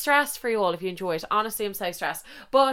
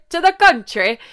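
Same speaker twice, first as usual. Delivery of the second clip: a lightly put-on Irish country accent, pitched up; attempted Irish country accent; 8 semitones higher, 6 dB louder than usual. Shown as a demonstration. Mocking herself as she tries a rural Irish accent. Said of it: "Sorry, what accent was that?".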